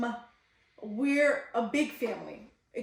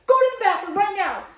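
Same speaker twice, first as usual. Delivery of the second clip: higher, louder, fast retold command; urgent barked order; 8 semitones higher, 7 dB louder, faster than usual. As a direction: act out an urgent shouted order. Acting out her urgent order.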